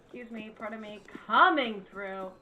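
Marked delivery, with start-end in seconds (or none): 1.3-2.3 annoyed. Real, not acted. Pushing through a game crowd.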